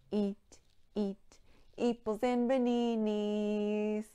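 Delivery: sung with an ee in place of each vowel; E sound verse. Shown as a demonstration. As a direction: sing "eeples and baninis" with every vowel as long ee. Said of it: "E sound".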